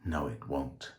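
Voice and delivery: soft, low whisper; whispered defiance. A narrator's reading. George defying the dead phone line, a low whisper. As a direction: whisper it under your breath. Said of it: "whispered George".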